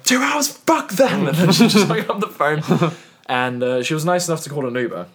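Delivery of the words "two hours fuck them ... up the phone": much higher, loud outraged yell; outraged shout. Retold or imitated. Retelling reaction to the ambulance wait, outraged yell. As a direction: blurt it out in disbelief. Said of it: "And so I was like".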